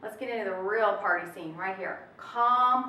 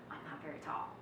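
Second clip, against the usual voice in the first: whisper; 14 dB quieter, faster than usual. Real, not acted. Aside about her height.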